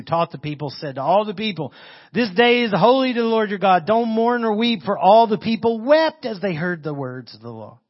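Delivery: loud, high-pitched proclamation of scripture; ringing public Bible reading. Acted. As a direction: proclaim the verse to all the people. Reads scripture aloud to congregation.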